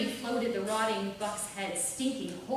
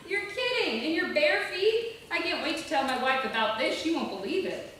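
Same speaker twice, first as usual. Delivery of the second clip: high, loud, laughing disbelief; amused disbelief; 8 semitones higher, 6 dB louder than usual. A narrator's reading. Rescuer amused, telling her his wife won't believe it. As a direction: delight at the crazy story. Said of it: "threw back his head and laughed".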